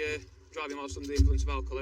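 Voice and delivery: usual speaking voice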